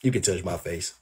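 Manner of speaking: dropped low and soft, coaxing; quiet mocking reassurance to her; soft mock reply. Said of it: "I would be like".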